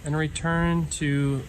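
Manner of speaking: calm meditation guide with long pauses; guided meditation. Real, not acted. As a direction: ease listeners inward, leaving silence between phrases. Leads a breathing meditation.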